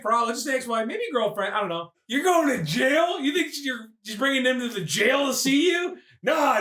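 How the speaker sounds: ordinary delivery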